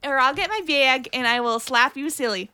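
hammed-up Midwestern threat; Midwestern accent. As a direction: scold in a broad Midwestern accent.

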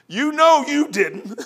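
gently calling him out; the Lord's voice. Acted. Voicing the Lord.

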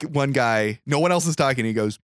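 normal talking voice

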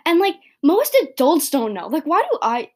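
high, loud exasperated outburst; sudden revelation. Real, not acted. Podcaster having a revelation on recording, exasperated outburst. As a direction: blurt it out in rising disbelief. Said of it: "revelation I, I'm having".

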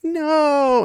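high retold cry of no; mock shout. Egwene shouting to stop the sword, high mock yell. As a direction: yelp it out high, hammed-up. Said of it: "and Egwene shouts".

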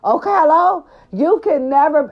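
high, loud sassy mimic of the diss; sassy mock diss. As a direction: throw the diss back with attitude.

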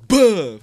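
loud high boom; punch sound effect. Retold or imitated. Telling how the attacker punched him, loud boom. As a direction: slam the word out like a hit landing.

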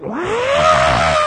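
high shrieked wah; mock startled shriek; startled yelp. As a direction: imitate her jumping in fright. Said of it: "and she went".

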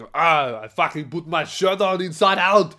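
loud, exasperated groaned complaint; put-on voice, loud and fed up; mock frustration. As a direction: act out an exasperated outburst at yourself. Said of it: "you get really frustrated".

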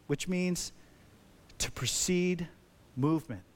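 ordinary talk

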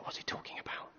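hushed whisper between friends. Acted. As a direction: whisper it so the teacher can't hear. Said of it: "they start whispering to each other".

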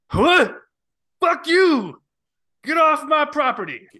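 put-on high, loud cranky old-timer yelling; furious geezer shouting at trespassers; angry old man yell. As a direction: scream like a grumpy old man chasing kids off. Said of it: "can you do that voice again".

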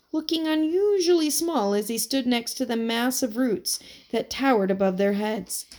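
ordinary delivery

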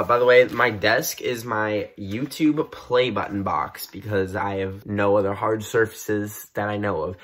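ordinary delivery

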